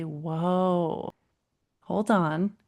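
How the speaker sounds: long, drawn-out soothing whoa with a falling end; calm slow whoa. Shown as a demonstration. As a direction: settle the upset child with a slow whoa. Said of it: "we call whoa, low, and slow".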